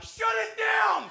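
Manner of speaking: high, slow, drawn-out yell; shouted order. Retold or imitated. Ride kid stopping the whole coaster, drawn-out yell. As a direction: bellow it like an alarm. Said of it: "but I'm not".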